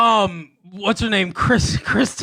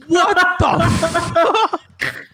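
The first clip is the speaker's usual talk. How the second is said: shocked disbelief; 8 semitones higher, 3 dB louder, much slower than usual. Real, not acted. Shocked reaction to racist line.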